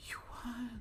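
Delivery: high whispered you what; incredulous whisper. Real, not acted. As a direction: hiss of disbelief under breath. Whispering at the scanner.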